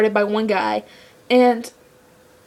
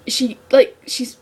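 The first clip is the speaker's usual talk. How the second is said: ordinary talk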